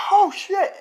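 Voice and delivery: very high, loud startled yelp; acted alarm at full squeal; shocked panic. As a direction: burst out in mock panic. Voicing his shock on waking.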